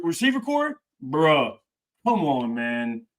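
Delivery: usual speaking voice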